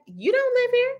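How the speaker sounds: very high, disbelieving question; mock incredulous retort. Dog owner answering a nasty commenter, high mock disbelief. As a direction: throw the comeback way up in pitch, playfully affronted.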